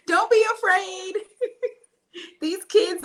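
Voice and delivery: high-pitched, talking through laughter; real laughter spilling into the words; laughing. Real, not acted. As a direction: urge them on while cracking up. Urging parents through her own laughter.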